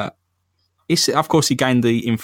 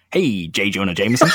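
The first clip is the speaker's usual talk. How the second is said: Stan Lee voice; 2 semitones lower than usual. Acted. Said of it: "why is your Stan Lee so good?".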